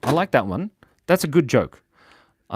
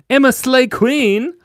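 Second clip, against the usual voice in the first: hype announcement; 13 semitones higher, 7 dB louder than usual. Real, not acted. Hyping up a viewer's name.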